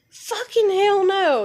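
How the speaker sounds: retold heated no; angry refusal. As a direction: refuse it hotly, dragging it out. Her friends ask her to calm.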